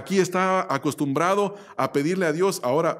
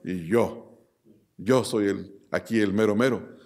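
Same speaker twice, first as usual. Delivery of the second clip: much lower, puffed-up voice of a proud king; boastful king; 7 semitones lower than usual. Acted. Preacher voicing Nebuchadnezzar boasting over his kingdom, lowered proud voice. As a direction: play the arrogant ruler bragging about himself.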